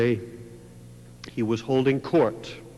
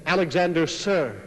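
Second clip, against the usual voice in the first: loud, higher, crisp reply; snapped to attention; 6 semitones higher, 7 dB louder than usual. A narrator's reading. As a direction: bark it out like a soldier at attention. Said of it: "the boy snapped to attention".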